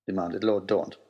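usual speaking voice

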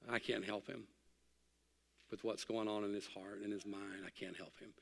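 fading to a hush; quiet grief. Real, not acted. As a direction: let the sorrow sink into near silence. Grieving for grandfather, fading.

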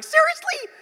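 squeaky high disbelief; incredulous. Retold or imitated. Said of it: "I'm like".

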